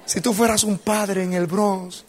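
high-pitched appeal to God, a little firmer; acted plea. Young pastor questions God, acted high plea. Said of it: "así le dije".

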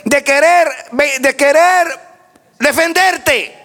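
high, emphatic revival preaching; fervent preaching. Real, not acted. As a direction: exhort the congregation forcefully, phrase by phrase. Preaching at full force. (predicación enérgica y exhortativa)

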